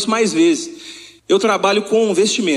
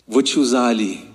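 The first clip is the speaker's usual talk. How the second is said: voice of God; 9 semitones lower than usual. Retold or imitated. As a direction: lower the voice to give God's command. God replies to him.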